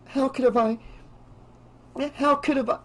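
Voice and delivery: high, tearful, halting voice; soft crying. Acted. Girl blames herself for friends' deaths, tearful high voice. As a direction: choke it out in broken starts, voice high. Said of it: "She began to cry softly".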